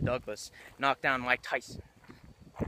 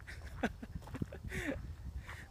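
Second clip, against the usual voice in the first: high laugh; 11 dB quieter than usual. Real, not acted. Laughs after joke.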